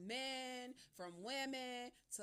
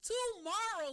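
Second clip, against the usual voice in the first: tomorrow! called out high and loud; excited call; 10 semitones higher, 8 dB louder, slower than usual. Real, not acted. Host hyping tomorrow's show, loud and excited. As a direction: announce it big.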